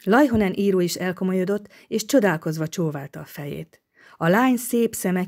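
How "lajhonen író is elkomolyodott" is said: plain reading voice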